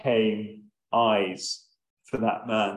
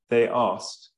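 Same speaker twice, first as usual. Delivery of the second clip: ordinary delivery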